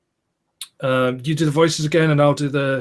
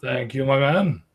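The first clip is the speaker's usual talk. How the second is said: ordinary delivery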